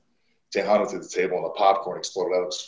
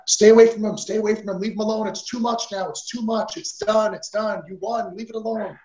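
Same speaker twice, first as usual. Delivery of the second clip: high, loud pleading woman's voice; a woman in the crowd begging, high and loud; 12 semitones higher, 4 dB louder than usual. Retold or imitated. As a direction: beg him to back off like a frantic fan. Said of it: "the woman was going".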